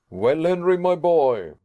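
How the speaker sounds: bright, hearty greeting to his nephew; cheerful. A narrator's reading. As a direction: cheer him up as you call his name. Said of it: "he said in a cheerful way".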